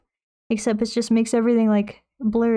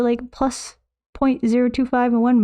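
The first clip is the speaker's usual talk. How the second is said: usual speaking voice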